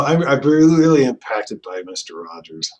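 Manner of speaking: ordinary talk, nothing marked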